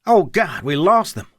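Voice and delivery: high, loud cry of loss; anguished cry at the lost pilots; cried out. A narrator's reading. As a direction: cry it out in dismay. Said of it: "Vic cried".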